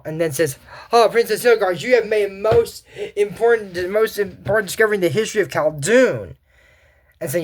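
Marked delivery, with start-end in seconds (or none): 0.9-6.4 grand king. Acted. King praises princess grandly.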